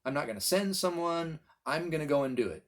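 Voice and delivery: ordinary talk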